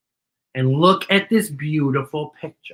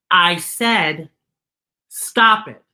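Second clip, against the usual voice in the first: firm, higher, louder stop; a little louder; 3 semitones higher, 5 dB louder, much slower than usual. Acted. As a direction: put your foot down on stop. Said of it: "even a little louder this time".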